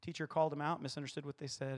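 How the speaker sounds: normal talking voice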